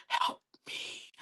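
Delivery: imitating a furtive whispered "help me"; barely voiced, very soft mock plea. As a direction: whisper it like a secret cry for help. Mouths a secret plea to friends.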